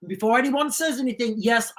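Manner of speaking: ordinary talk, nothing marked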